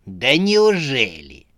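slow, drawn-out mock amazement; feigned surprise. A narrator's reading. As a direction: pretend astonishment, stretching the words. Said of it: "с наигранным удивлением спросила Сюзанна". (с наигранным удивлением)

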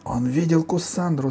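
put-on high-pitched, urgent girl's cry; крик девочки. A narrator's reading. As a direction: call out the accusation high, without much volume. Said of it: "закричала Алиса". (вскрикивает высоким голосом)

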